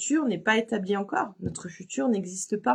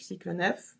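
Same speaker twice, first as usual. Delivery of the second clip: ordinary talk, nothing marked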